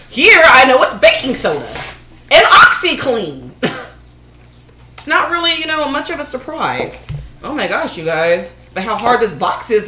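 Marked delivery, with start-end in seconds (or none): none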